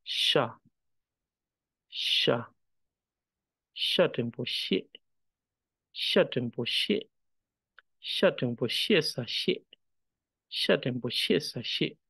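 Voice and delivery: "sha", then "Sha tempo she", each built up as its own unit; spelling-out drill on "Sha tempo she sa she"; character reading. Shown as a demonstration. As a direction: sound "sha" alone, then chant out "Sha tempo she sa she" evenly. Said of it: "if we were doing the character reading".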